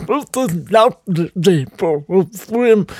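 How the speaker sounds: put-on high frail old lady voice; Auntie Ruby. Teasing family in the sea, as great-aunt. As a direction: chirp it like the tiny aunt. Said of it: "I started going".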